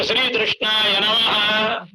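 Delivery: "శ్రీకృష్ణాయ" drawn out with tru for kru; deliberate mispronunciation. Shown as a demonstration. Preacher giving the altered name its meaning, slowly saying "శ్రీకృష్ణాయ" as shri-trushnaya. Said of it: "అన్నా ఆయన పేరేనయ్యా".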